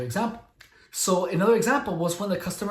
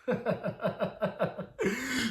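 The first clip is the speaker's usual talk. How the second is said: a long, breathless high-pitched laugh; high airy laugh; 5 semitones higher, 8 dB quieter than usual. Real, not acted. Telling the story and laughing at her own trick. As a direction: laugh helplessly at the memory.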